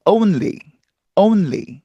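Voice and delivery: "only" as "ohn-lee"; English-style "only", no doubled l; proper pronunciation. Shown as a demonstration. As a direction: keep a clear n before l in "only". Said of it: "제대로 발음하면".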